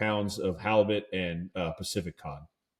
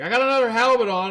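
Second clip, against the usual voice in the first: high, loud, fast shout across the boat; a buddy calling out his catch; excited call; 12 semitones higher, 7 dB louder, faster than usual. Retold or imitated. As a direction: yell it out, thrilled to have a fish on. Fellow angler shouting from boat.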